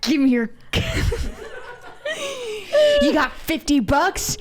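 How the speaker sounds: high demand breaking into laughter; giggly, hammed-up money demand; mock demand. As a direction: try the dominatrix act while cracking up. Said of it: "Start over".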